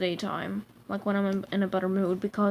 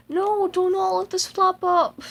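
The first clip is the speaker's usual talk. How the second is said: voicing the toy; 8 semitones higher, 4 dB louder than usual. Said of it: "it looks like as if it's like".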